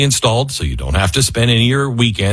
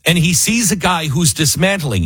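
ordinary delivery

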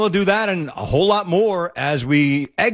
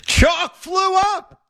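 high, slow, drawn-out exclaim; excited exclaim; 12 semitones higher, much slower than usual. Real, not acted. Host relishing the tennis clip, high and stretched out. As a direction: stretch it out with glee.

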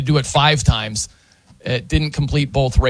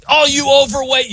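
wrestling heel taunt; 10 semitones higher, 3 dB louder than usual. Retold or imitated. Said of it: "he's like".

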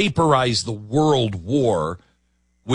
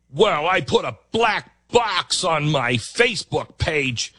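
mock smug activist; 7 semitones higher than usual. Host mocks slacktivist's excuse.

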